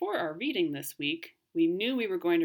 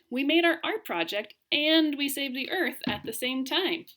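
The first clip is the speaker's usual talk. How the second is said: exclaiming; 8 semitones higher, 5 dB louder than usual. Acted. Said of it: "Grace exclaimed".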